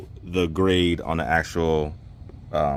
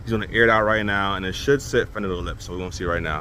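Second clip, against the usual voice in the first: ordinary delivery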